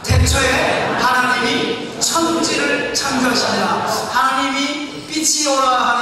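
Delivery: slow, loud, lifted recitation; solemn scripture reading. Acted. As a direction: intone the verse with weight. 성경을 소리 내어 낭독. (천천히 힘주어 읽는 성경 낭독)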